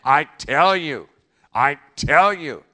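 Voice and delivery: "tell" punched loud; loud preacher's declaration; loud emphatic quote. Acted. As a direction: ring out "For I tell you". Repeating Jesus' words.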